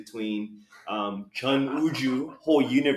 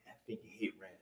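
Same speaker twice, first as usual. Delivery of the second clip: imitating the whisper; 5 semitones lower, 18 dB quieter, much faster than usual. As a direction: echo the punchline under the breath. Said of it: "repeats the boss's punchline".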